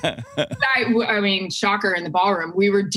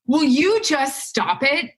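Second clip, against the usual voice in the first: slow, higher, fed-up fatherly scolding; exasperated dad; 7 semitones higher, slower than usual. Retold or imitated. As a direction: drag it out like a dad who's had enough. Dad scolds nervous daughter on phone.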